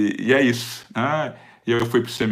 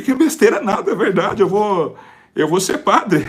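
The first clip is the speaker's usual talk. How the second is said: higher, laughing through the words; laughing insistence; 7 semitones higher, 4 dB louder than usual. Retold or imitated. Son laughing as he insists to his mother. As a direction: laugh while insisting it's true.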